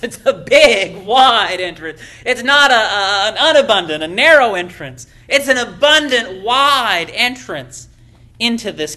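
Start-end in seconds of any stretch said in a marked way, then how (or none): 0.0-5.1 louder, driving each word home; emphatic preaching. Acted. Preacher contrasting wide and narrow. 5.3-7.9 slow, louder, leaning on "wide"; slow emphasis. Acted. Preacher stretching out "wide.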